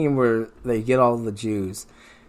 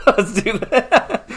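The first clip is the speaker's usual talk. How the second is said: laughing; 11 semitones higher, 4 dB louder, much slower than usual. Real, not acted.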